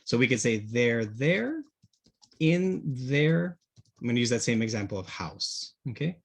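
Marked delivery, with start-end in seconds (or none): 0.6-3.6 slow demo. Shown as a demonstration. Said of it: "La misma pronunciación".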